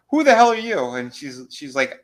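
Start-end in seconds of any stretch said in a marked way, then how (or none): 0.1-0.8 high, loud demanding yell; hammed-up retold yell; demanding shout.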